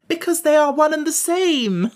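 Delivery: high, loud, gleeful declaration; merry. A narrator's reading. Jellia revealing her trick to the king, merrily. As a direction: let the joke burst out, highly amused. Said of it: "declared the girl, now laughing merrily".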